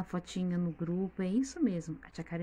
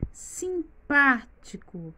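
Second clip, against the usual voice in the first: simpático drawn out, sim-PÁ-tico, PÁ high and strong; slow, higher simpático broken into syllables; stress on PÁ; 6 semitones higher, 4 dB louder, much slower than usual. Shown as a demonstration. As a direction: punch the PÁ in simpático, very slowly. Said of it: "Vocês observaram que é o pá".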